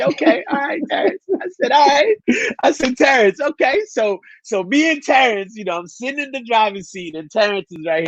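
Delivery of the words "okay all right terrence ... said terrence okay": high, hesitant imitation of himself; mock high reply. Retelling how he agreed to the test drive, voice pitched high. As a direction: play your younger self caving in, voice up high.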